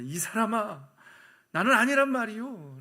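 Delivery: loud, high indignant protest; Peter's denial. Acted. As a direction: insist forcefully that it isn't you. Acting Peter's second denial. (큰 소리로 강하게 부인)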